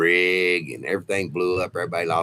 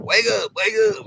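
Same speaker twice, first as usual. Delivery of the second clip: much higher, loud wake-up shout; calling him awake; 14 semitones higher, 3 dB louder than usual. Retold or imitated. Tech shaking his leg to wake him, shouting.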